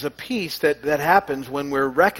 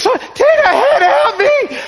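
put-on pleading toddler voice; 9 dB louder, much slower than usual. Imitating grandson crying for help.

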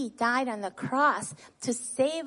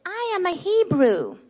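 louder, higher leading call, each word set out clearly; call-out for children to repeat; 6 semitones higher, 5 dB louder than usual. Shown as a demonstration. Teacher calling the verse for children to echo back. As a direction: call the phrase out so the class can echo it. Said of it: "we're gonna say the Bible verse together".

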